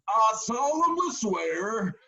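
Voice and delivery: high, slow, loud mock-solemn proclamation; hammed-up solemn swearing; solemn oath. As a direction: declaim it like a court oath, slowly. Groom reading vows like oath.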